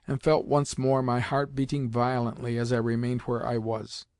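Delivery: plain reading voice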